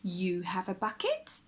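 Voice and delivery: ordinary delivery, nothing marked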